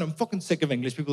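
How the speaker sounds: normal talking voice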